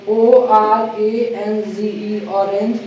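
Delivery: letters spelt slowly, then ऑरेंज; slow spelling. Shown as a demonstration. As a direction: dictate each letter slowly. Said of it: "ओ से एक word लिखा". (धीरे-धीरे अक्षर बोलकर ऑरेंज)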